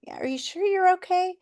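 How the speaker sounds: high, pressing concerned question; doubtful concern. Retold or imitated. Doctor in elevator presses, high worried question. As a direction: press him, clearly worried. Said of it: "he was like".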